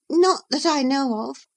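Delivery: put-on high, hesitant girl's voice, caught out; a nervous little dodge, pitched high; stammering Catherine. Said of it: "stammered Miss".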